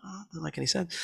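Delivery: high, hushed mock bafflement; retold bafflement. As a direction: puzzle it out in a small high voice. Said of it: "I was like".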